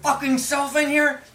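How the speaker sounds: trapped man yelling at the guard through the door; furious yell. Acted. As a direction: snap back at him in a rage. NPCs arguing through door.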